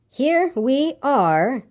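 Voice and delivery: very slow, high, deliberate delivery; stretched, measured pronouncement; slow and careful. A narrator's reading. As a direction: spell it out word by word. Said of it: "very slowly and carefully".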